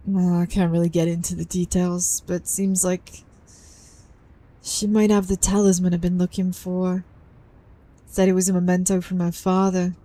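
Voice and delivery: low, subdued character voice; reserved game character. Acted. Talking about a girl's misfortune, reserved low voice. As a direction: keep it low and understated, slightly guarded.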